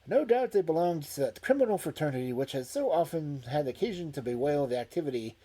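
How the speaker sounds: loud, formal newspaper reading; news report read aloud. A narrator's reading.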